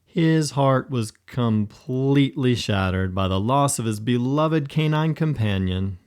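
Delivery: usual speaking voice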